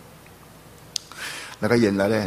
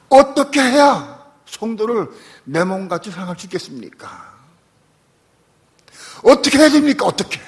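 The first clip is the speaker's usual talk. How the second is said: much higher, louder desperate plea; desperate cry; 9 semitones higher, 8 dB louder than usual. Retold or imitated. Pastor begging God how to love his flock, desperate cry. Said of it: "그렇게 기도했어요, 그 밤에".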